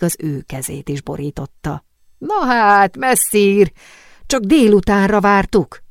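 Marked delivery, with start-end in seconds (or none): 2.2-5.8 bright, loud welcoming call; eager exclamation. A narrator's reading. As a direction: call out with delighted surprise. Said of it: "kiáltott lelkesen".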